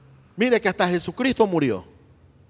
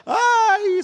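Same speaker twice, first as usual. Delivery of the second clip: high wailing ay of a weeping woman; weeping cry; 14 semitones higher, 7 dB louder than usual. Retold or imitated. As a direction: cry out ay like a grieving woman at the tomb. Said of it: "lloraba y decía". (lamento agudo de mujer que llora)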